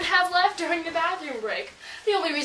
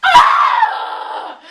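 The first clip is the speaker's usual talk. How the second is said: hammed-up shriek, much higher and louder; fake wail; 13 semitones higher, 10 dB louder than usual. Friend insults the dog, girl shrieks in mock grief.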